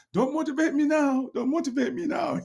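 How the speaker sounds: put-on high, loud exasperated girl voice; annoyed daughter. Older daughter shuts down her dad's pep talk, high and exasperated. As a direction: squeal it like a fed-up teenage daughter. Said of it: "She go".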